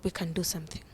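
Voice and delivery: whispered confidence; doctor's hushed whisper. Retold or imitated. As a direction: whisper it like a secret. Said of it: "she's like".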